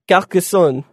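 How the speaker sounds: attempted French accent on Carcassonne. Shown as a demonstration. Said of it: "attempt to say it with the French accent".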